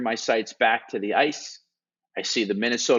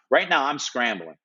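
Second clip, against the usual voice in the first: usual speaking voice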